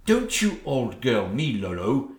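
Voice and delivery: ordinary talk, nothing marked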